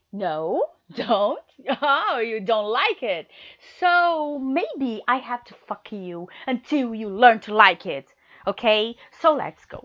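high, loud mocking taunt; loud gleeful domineering taunt. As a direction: crow over them, loud and high. Dominant partner taunts loudly.

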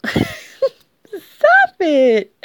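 high giggling protest; laughing. Real, not acted. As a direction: squeal stop it while laughing. Giggling, pinned down playfully.

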